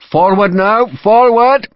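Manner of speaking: high, loud bellow rising in urgency; bellowed order. A narrator's reading. Brigade major urging the line to advance, bellowing. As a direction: bellow the men onward. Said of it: "a brigade major bellowed".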